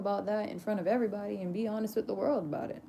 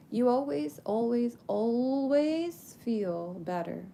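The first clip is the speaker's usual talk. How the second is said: "always" repeated, higher, the last one long drawn out; emphatic repetition; 4 semitones higher, 5 dB louder, slower than usual. Real, not acted. Promising listeners relief after crying, "always" stretched emphatically. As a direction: lean on each "always", stretching the final one.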